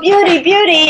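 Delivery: imitating herself as a small child, high-pitched; little child. Granddaughter answers her grandmother on the stairs, high childlike call. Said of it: "I look at her and I go".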